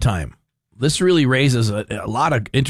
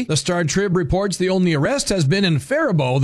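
higher-pitched, punchy announcer read; scripted radio commentary; 6 semitones higher than usual. Acted. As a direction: deliver a prepared segment without letting up. Reads his prepared commentary segment.

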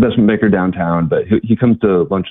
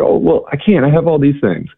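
mild protest; 8 semitones higher than usual. Retold or imitated. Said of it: "she's like".

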